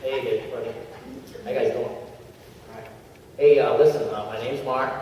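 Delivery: mock a flat, unexcited testimonial; not that excited. Shown as a demonstration. Trainer mocks a dull first testimonial speaker, flat and low-energy. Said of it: "Not that excited".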